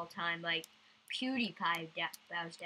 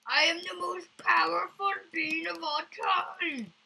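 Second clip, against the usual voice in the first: hammy villain boast; 8 semitones higher, 6 dB louder than usual. Acted. Mock grand boast whispered.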